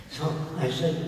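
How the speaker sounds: ordinary talk, nothing marked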